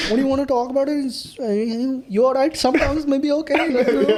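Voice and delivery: a deliberately bad Indian accent for laughs; mock Indian accent. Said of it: "can you do, like, a really, like, poor Indian accent?".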